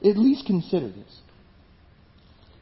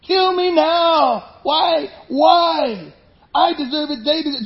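higher, louder furious plea, kill me now; a raw prophet's outburst, higher and louder; angry despairing cry; 9 semitones higher, 4 dB louder, slower than usual. Acted. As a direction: shout it out at God like a fed-up prophet. Acting out angry Jonah.

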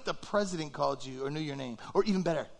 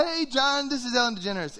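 high, bright-hello phone greeting; Ellen DeGeneres; 5 semitones higher than usual. Acted. Ellen phones an unsuspecting man, high cheery greeting. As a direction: call out a celebrity's hello, pitched up. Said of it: "Ellen DeGeneres calls on the phone".

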